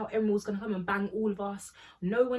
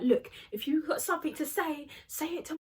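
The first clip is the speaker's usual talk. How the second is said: lightly acted angry challenge, pitched up; mock snappy confrontation, higher voice; Hannah snapping; 6 semitones higher than usual. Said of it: "Hannah just snaps".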